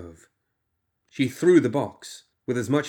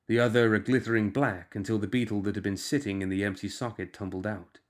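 usual speaking voice

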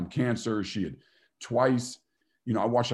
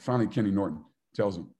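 ordinary talk, nothing marked

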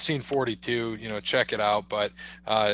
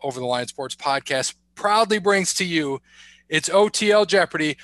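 ringmaster; 6 semitones higher, 7 dB louder than usual. Pretend. Ringmaster intro continues.

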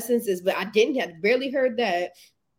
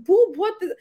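much higher and louder, indignant; half-joking outrage, pitched way up; indignant disbelief; 9 semitones higher, 5 dB louder than usual. Real, not acted. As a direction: demand to know who started it. Indignant rant about the earrings.

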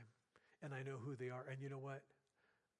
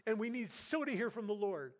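louder, higher preaching build; rising preaching; 6 semitones higher, 7 dB louder than usual. Real, not acted. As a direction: swell into full preaching fervour. Preaching ramps up.

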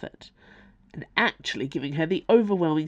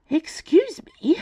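high, faint, half-whispered voice; 11 semitones higher, 4 dB louder than usual. A narrator's reading. Bella addressing talking furniture, faint. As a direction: squeak it out faintly. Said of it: "she said faintly".

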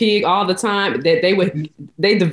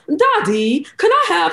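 higher-pitched imitation of a British-accented child; put-on British accent; 7 semitones higher than usual. Little cousin asking Daddy for something, in a mock British accent. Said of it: "American children are talking with British accents".

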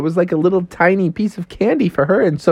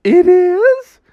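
high-pitched hopeful question; hopeful surprise; 4 dB louder than usual. Acted. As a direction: ask in high, dawning wonder. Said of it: "he was like".

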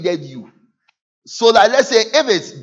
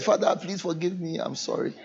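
whispered bedside prayer; 10 dB quieter than usual. Retold or imitated. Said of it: "you kneel down by the bed".

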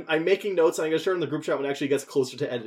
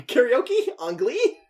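incredulous; 8 semitones higher, 6 dB louder, slower than usual. Real, not acted. Incredulous mockery.

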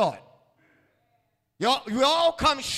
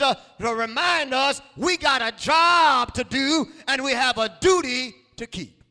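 louder, rising preacher call; preaching at full force; 2 semitones higher, 5 dB louder than usual. Acted. Preacher rallying the church to its duty, forceful. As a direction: drive it home to the pews.

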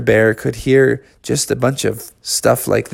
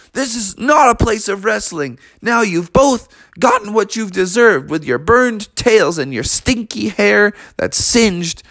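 lecturing father bear; 7 semitones higher than usual. Acted.

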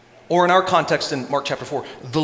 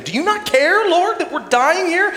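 high, desperate plea over the storm; panicked disciples; 13 semitones higher, 4 dB louder than usual. Acted. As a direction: cry out as if about to drown.

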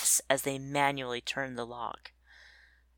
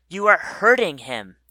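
loud, higher angry charge; angry accusation; 4 semitones higher, 10 dB louder than usual. A narrator's reading. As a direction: shout the accusation at the master. Mirin Anue glares, accuses.

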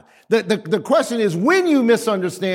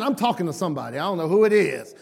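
ordinary delivery

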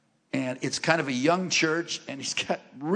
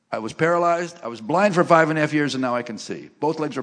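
preaching at full force; 4 semitones higher, 8 dB louder than usual. Acted. Preaching his healing testimony.

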